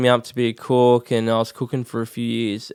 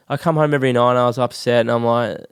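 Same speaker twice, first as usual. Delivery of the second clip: ordinary talk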